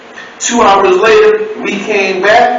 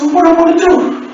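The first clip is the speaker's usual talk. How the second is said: high plaintive question; mama voice; 10 semitones higher, faster than usual. Retold or imitated. Asking the operator what to do, high mama voice. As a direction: ask it up high and helpless. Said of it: "mama voice".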